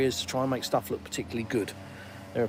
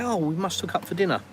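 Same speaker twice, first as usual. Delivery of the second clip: higher-pitched mock enthusiasm; insincere suggestion; 5 semitones higher than usual. Acquaintance met again proposing dinner, falsely enthusiastic. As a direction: gush a plan that will never happen. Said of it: "it's not sincere, it's not genuine".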